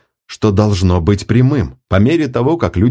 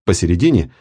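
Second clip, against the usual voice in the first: ordinary delivery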